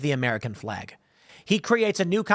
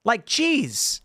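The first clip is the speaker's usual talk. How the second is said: high-pitched exasperated complaint; pissed off; 6 semitones higher, slower than usual. Acted. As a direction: whine in comic outrage at the eavesdroppers. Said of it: "what pissed me off is".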